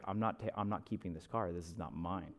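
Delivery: low, very soft imitation of the husband; hushed, dug-in mock refusal; retold quiet refusal. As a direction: refuse it under your breath, pitch dropped. Husband refusing the car, acted out.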